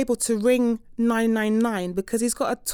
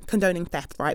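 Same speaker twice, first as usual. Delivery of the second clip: normal talking voice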